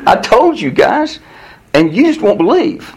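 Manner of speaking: high, drawn-out amused reproach; mock exasperation. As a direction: stretch it out, pitched high, exasperated.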